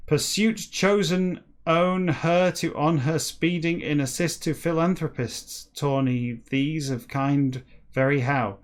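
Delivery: slow, deliberate read-aloud; story narration. Acted. Reader reciting a backwards whale novel to listeners, measured narration. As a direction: read each phrase out with measured emphasis.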